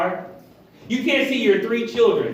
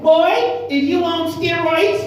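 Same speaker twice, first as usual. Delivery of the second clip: put-on high, loud, light Jamaican accent; little Jamaican accent; 8 semitones higher, 3 dB louder, slower than usual. Bishop teased over his muscles, light Jamaican accent. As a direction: exclaim it with a hint of Jamaican. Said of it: "he had a little Jamaican accent".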